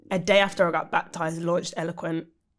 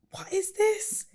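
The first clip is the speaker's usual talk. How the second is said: high, puzzled asking; confused; 13 semitones higher, 4 dB quieter, slower than usual. Retold or imitated. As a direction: wonder aloud what's going on. Retold confusion at the conference.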